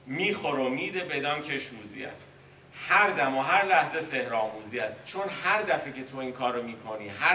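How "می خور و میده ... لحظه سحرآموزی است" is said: بیت مثنوی را کشیده و آهنگین بخوان; آرام و باوقار، مثل درس مثنوی; شعرخوانی. Acted. Reciting the same verse again. (خواندن آهنگین بیت برای شاگردان)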